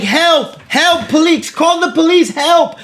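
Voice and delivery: imitating a man yelling for help; mock cry for help. The beaten man crying out for police, mock yell. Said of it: "He's like".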